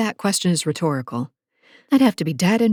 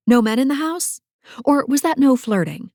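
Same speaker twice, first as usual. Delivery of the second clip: playful mock innocence, higher; teasing; 6 semitones higher than usual. A narrator's reading. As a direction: tease him with fake confusion. Teasing feigned forgetting.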